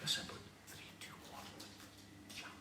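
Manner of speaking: barely audible whispered countdown. Acted. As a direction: whisper shyly to the horse. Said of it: "whispers to the horse".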